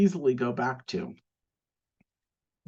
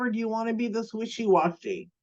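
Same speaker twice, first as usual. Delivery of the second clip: ordinary talk, nothing marked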